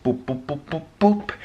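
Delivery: playful stammer climbing to a high squeak; goofy boops stepping up in pitch; silly rising boops. Pretend. Playful stand-in for the word vlog.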